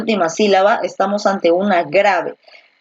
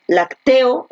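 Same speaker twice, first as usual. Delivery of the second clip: lacteo with a high, slow stressed TE; stress on middle syllable; 4 semitones higher than usual. Shown as a demonstration. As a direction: raise and stretch TE in lacteo. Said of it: "tú no dices".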